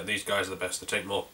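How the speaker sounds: usual speaking voice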